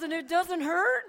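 very loud, high disbelieving question; acted disbelief at full volume; incredulous husband. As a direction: imitate an astonished husband, almost shouting.

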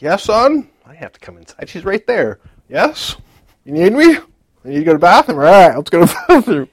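high, loud eager "yes son"; eager loud reply. Retold or imitated. Husband answering his son loudly to escape his wife, eager and high. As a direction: overeager dad rushing to answer. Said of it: "and I was like".

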